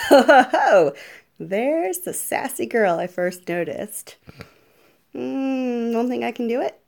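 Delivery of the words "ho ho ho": big loud hearty laugh. Acted.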